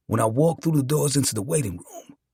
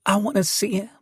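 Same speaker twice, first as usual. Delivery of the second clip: higher-pitched pleading request; lightly voiced new mother; 7 semitones higher than usual. A narrator's reading. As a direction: voice her a little higher, asking for her baby. Weak new mother asks for baby.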